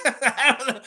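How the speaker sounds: high, helpless laughing delivery. Real, not acted. Host cracking up after own impression, laughing. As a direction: talk through real laughter.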